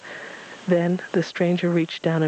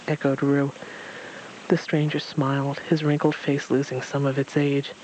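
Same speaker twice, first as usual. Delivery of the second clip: plain reading voice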